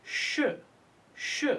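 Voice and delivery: "sh" sounded out by itself; isolated sh sound. Shown as a demonstration. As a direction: give "sh" as a sound, not as letters. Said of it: "E D is preceded by sh".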